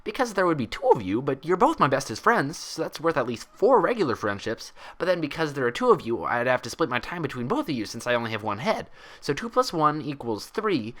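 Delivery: high, chattery pony explaining nonsense math; bubbly Pinkie voice. Acted. Pinkie explaining her friendship math to Twilight, bubbly.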